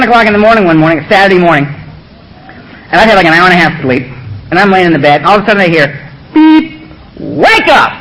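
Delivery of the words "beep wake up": high, drawn-out mock alarm call; Donald's wake-up call. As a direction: stretch it like a voice on the answering machine. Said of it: "And it's Donald's voice".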